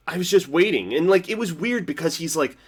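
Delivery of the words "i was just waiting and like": ordinary talk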